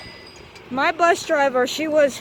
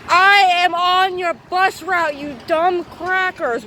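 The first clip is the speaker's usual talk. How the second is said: angry yell; 4 semitones higher, slower than usual. Retold or imitated. Student yelling at the bus driver who refused her. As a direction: spit it out slowly, furious.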